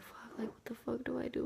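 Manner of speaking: very soft, scared whisper; scared kid whispering after the prank; panicked whisper to herself. Retold or imitated. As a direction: breathe it out in a panic. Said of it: "I was just like".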